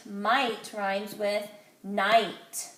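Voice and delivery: Might and night drawn out very slowly so the rhyme stands out; slow it right down to show the rhyme; stressing the rhyme. Shown as a demonstration. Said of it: "we worked on the word night".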